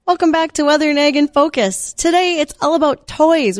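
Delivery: high-pitched, upbeat host welcome; radio show opener. Real, not acted. Host welcoming listeners back to a farm and weather show, high announcer delivery. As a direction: open the segment brightly, pitched well up.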